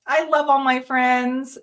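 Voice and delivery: ordinary delivery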